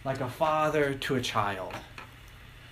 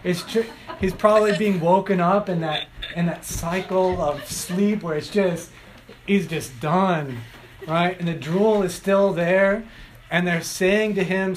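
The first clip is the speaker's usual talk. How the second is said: ordinary talk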